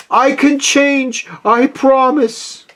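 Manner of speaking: high, slow, drawn-out begging; anguished high-pitched promise; desperate plea. A narrator's reading. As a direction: beg for your life. Scrooge begs at his grave.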